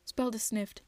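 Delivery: usual speaking voice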